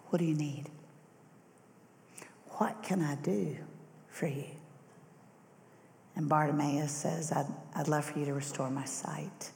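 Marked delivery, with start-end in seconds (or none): 0.1-4.5 much softer, breathy near-whisper; Jesus gently asking the blind man, soft and tender; gentle question. Acted. 7.3-9.5 whispered plea. Acted. Bartimaeus pleads to Jesus.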